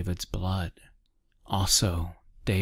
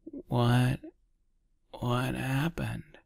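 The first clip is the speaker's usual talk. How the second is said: lightly rasped, higher groggy question; a touch of hoarseness, pitched up; raspy voice; 6 semitones higher, slower than usual. A narrator's reading. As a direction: croak it out slowly, lightly hoarse. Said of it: "His voice sounded like a cheese grater on concrete".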